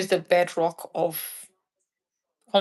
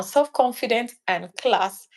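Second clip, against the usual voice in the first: usual speaking voice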